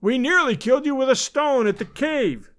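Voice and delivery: high, gloating villain's shout; vengeful, high-pitched calling; frantic cry. A narrator's reading. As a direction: cry out the taunt in frenzy. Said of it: "he cried".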